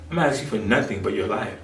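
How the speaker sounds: normal talking voice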